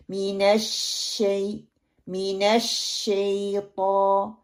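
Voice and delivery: long pressed SH in मिनश शैतान; firm shadd. Shown as a demonstration. Teacher modelling the full sheen of मिनश शैतान, held. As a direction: hold the doubled sheen, then slow SHAY-TAA. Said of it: "शद की मजबूती और शीन".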